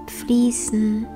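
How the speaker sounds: a single whispered word. Acted. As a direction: whisper it like flowing water. Meditation cue.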